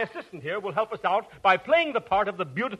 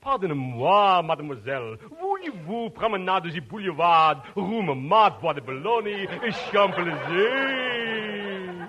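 mock French phrasebook. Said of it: "and in perfect French".

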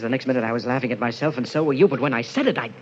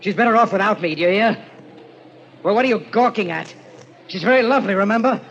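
loud, high, defensive outburst; agitated rant, voice pushed up; unhinged anger; 6 semitones higher, 5 dB louder than usual. Acted. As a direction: lash out wildly at a prying stranger. Unhinged husband snaps at hotel clerk.

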